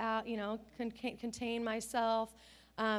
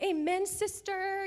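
slow preacherly amen, higher; church amen; 8 semitones higher, 6 dB louder, slower than usual. Retold or imitated. Mom cheering her daughter in church style, slow amen. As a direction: call it out like a rising church lady. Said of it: "I'm like".

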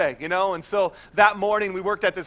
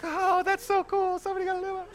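wife's oblivious delight; 13 semitones higher, 3 dB quieter than usual. Retold or imitated. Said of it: "She was actually like this".

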